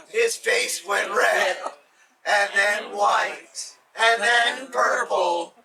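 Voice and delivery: slow, loud and drawn out for the audience to join; loud, slow chant inviting listeners to say it together; slow call-and-response. Real, not acted. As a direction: lead the crowd through each word. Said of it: "say it with me".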